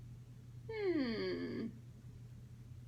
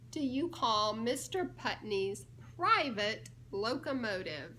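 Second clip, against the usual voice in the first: ordinary delivery, nothing marked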